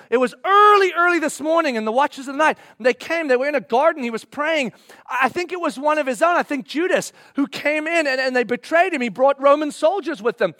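loud, high, urgent back-and-forth; agitated neighbor, frantic. Acted. Neighbors trading news of the arrest, loud and frantic. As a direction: tumble out the bad news in alarm.

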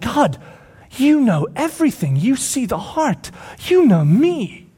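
high, drawn-out pleading; frustrated Peter. Acted. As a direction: cry out to be understood. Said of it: "Peter gets frustrated".

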